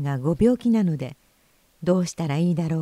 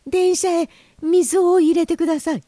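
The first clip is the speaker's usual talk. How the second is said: high, loud little-fish cry; pleading loudly in a small creature's voice; urgent plea; 11 semitones higher, 6 dB louder than usual. Acted. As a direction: insist on it, voice pitched way up. Goldfish plead for water.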